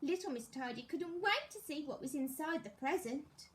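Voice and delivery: ordinary delivery, nothing marked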